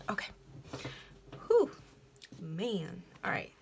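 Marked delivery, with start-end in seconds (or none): none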